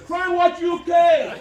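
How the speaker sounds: high, loud heckler's yell; drawn-out shout from the crowd; imitated shout. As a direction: shout it out slowly, stretched and high. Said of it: "someone shouted".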